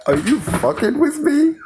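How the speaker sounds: very high imitated wail; mock crying yell. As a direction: mimic her tearful outburst in a squeaky pitch. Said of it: "she yells out".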